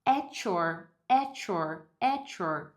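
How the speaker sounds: "At your" pronounced "a-chor"; T plus Y becomes CH. Shown as a demonstration. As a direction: blend T and Y in "At your" into one ch. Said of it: "vai virar um som de ch".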